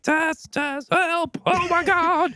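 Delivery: very high, strained mock screams for help; high-pitched, hammed-up cry of terror; panicked cries. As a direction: imitate a man screaming from far down a tunnel. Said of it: "you hear him like".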